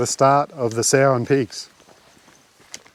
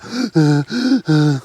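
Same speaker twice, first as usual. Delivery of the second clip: mock gasp; 3 semitones higher than usual. Shown as a demonstration. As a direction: demo breathless gasp into the mic. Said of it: "before I start running out of breath and going".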